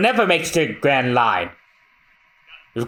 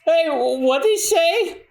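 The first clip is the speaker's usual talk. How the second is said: confused shout. Acted.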